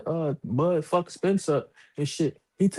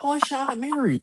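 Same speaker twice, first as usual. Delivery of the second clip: higher cheery mom voice; imitating mom; 11 semitones higher than usual. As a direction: announce the aunt brightly, pitched up.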